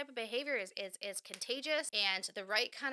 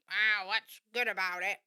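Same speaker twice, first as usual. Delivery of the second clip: grumpy grouch; 2 semitones lower, 6 dB louder than usual. Retold or imitated. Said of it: "somebody that's like".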